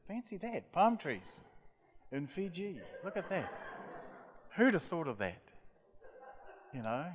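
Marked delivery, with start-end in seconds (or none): 0.1-5.4 higher, mock-amazed with a laugh; teasing sarcasm. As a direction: feign wonder at the obvious, laughing. Mocking the obvious vision.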